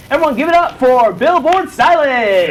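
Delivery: rally the crowd for the guest; announcer shout. Acted. Host sending the guest off to the audience, loud announcer shout.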